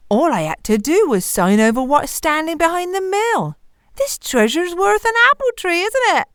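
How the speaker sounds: high, climbing, self-justifying miller; acted excitement building to a high question; pleased miller. A narrator's reading. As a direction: boast of the bargain, pitch rising to the end. Miller boasts of his bargain.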